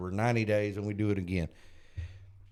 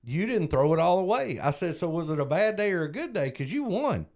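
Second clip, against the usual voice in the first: higher, upbeat pep talk to a sponsee; excited encouragement; 6 semitones higher than usual. Retold or imitated. Sponsor cheering his sponsee on, excited and upbeat. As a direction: cheer him on, pumped up and proud. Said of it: "And I went".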